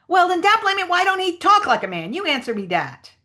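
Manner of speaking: high, loud triumphant dialect character; Jim. Acted. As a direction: drive the challenge home at high pitch. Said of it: "try to sort of read the Jim lines".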